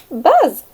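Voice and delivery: big bee buzz; loud buzz. Acted. Bee sound in story.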